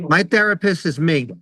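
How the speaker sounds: ordinary delivery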